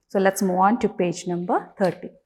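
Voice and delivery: ordinary talk, nothing marked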